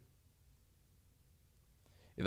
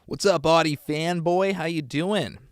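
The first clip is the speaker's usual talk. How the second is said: excited greeting; 6 semitones higher, 7 dB louder than usual. Real, not acted.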